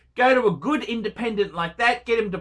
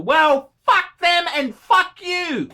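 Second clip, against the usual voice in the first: higher, louder, slow furious curse; angry outburst; 8 semitones higher, 4 dB louder, slower than usual. Real, not acted. YouTuber cursing viewer's trendy friends, angry shout. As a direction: spit it at him.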